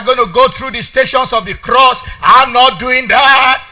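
high, loud, mounting declarations; full-force preaching. Real, not acted. Preacher refusing to mourn and vowing to rejoice, full-force preaching.

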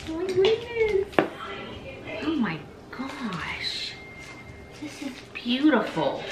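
slow, high, hushed amazement; awestruck. Real, not acted. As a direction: gasp it out in wonder. Unwrapping beautiful gift.

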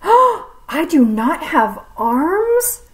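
gasping, slow shocked question. Acted. Snake learning it has no arms, gasping and shocked. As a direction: gasp as if it's news.

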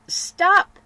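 sudden high shout; cry of stop. Acted. Nancy cutting off her gloomy friend, loud high cry. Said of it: "cried Nancy".

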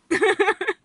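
high, quick laugh; giggle. Real, not acted. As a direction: laugh at your own joke. Laughs at own joke.

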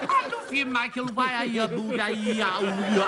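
mock the puppet's Geordie babble. Host mimicking puppet's declaration of love, exaggerated Geordie. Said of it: "Zippy doesn't talk like that".

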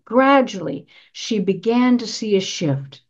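usual speaking voice

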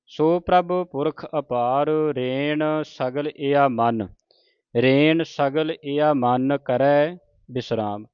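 slow, drawn-out Gurbani recitation; scripture recitation. Shown as a demonstration. Teacher models scripture for the congregation, slow measured recitation. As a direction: recite each word slowly with long vowels.

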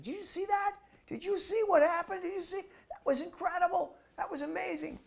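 very high, breathless awe; totally excited. Retold or imitated. A young soldier gushes to the monk, very high and excited. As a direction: gush with amazement. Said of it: "Totally excited".

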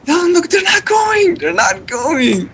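high, exasperated yell; frustrated shouting. Real, not acted. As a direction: shout in disbelief they won't move. Frustrated yelling teammates won't go.

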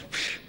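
short hissed "psh"; imitated putt noise. Telling a golf joke about the rabbi, an imitated "psh". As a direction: make a quick unvoiced puff for the putt.

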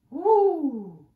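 loud, high, swooping woo; big storm sound made by voice; imitated whoosh. A narrator's reading. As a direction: howl a long falling woo.

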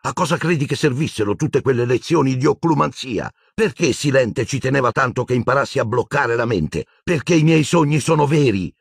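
urgent, heated pleading; angry boy convincing friends; frustrated insistence. A narrator's reading. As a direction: push hard, you must be believed.

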